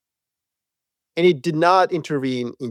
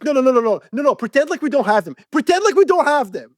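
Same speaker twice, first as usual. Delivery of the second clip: higher, quick no no no, mock brushing off; mock cocky American; 8 semitones higher, faster than usual. Americans refusing javelins for fairness, higher mock dismissive. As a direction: wave off the easy weapon, higher and quick. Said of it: "They'd be like".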